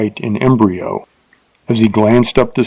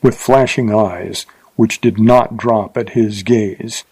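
plain reading voice

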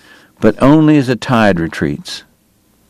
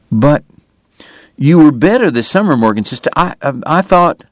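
higher, stammering protest; anxious younger brother; 6 semitones higher than usual. A narrator's reading. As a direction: plead with a catch.

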